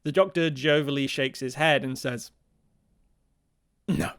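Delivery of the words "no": low superhero-style refusal; a single word in a mock Batman voice; lightly voiced caped-crusader "no". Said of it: "making him sound like Batman".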